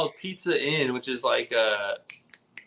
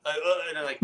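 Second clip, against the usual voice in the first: usual speaking voice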